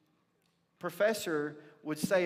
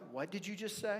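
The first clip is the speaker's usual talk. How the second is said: softer, higher incredulous question; stunned professor; 5 semitones higher, 6 dB quieter, faster than usual. Retold or imitated. Professor freezing at a student's answer, stunned. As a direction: ask it in hushed disbelief. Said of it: "freezes and his eyes get really big".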